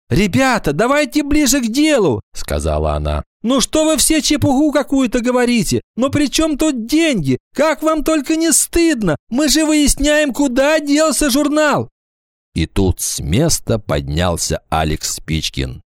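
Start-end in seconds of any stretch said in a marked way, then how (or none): 0.1-2.2 high-voiced class leader; displeased. A narrator's reading. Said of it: "Ира Сыркина недовольно поморщилась". 3.4-11.9 very high, exasperated schoolgirl; scolding. A narrator's reading. Class leader scolds her classmates.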